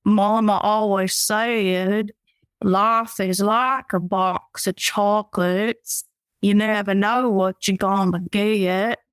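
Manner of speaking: slow Southern drawl impression; Forrest Gump. Guest from Alabama shows host her accent, slow Forrest Gump drawl. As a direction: draw it out slowly in a Southern accent. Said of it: "let me hear your Forrest Gump impression".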